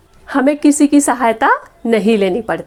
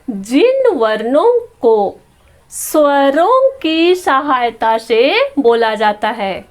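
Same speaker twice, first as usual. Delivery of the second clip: slow, deliberate reading with stretched words; 2 semitones higher, slower than usual. Shown as a demonstration. Teacher reads the consonant definition to pupils, slow read-out. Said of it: "पढ़ करके बताती हूँ".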